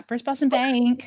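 slow Boston accent imitation. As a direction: say it the Boston way, slowly. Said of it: "was that a Boston accent she did?".

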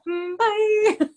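high-pitched little goodbye; mock brush-off. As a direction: toss off a squeaky, teasing exit. Retold brush-off to suitor.

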